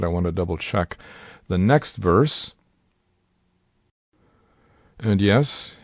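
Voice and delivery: ordinary talk, nothing marked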